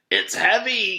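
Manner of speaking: high, loud whiny complaint; complaining. Acted. Louis complaining at the door about the heavy box. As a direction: whine it from under the box. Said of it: "he complained".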